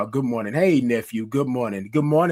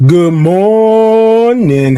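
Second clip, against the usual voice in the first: loud, high, long-held "morning"; big sustained call-out to the audience; drawn-out greeting call; 9 semitones higher, 14 dB louder, much slower than usual. Real, not acted. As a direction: belt out a very slow morning greeting. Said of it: "let me try it one more time".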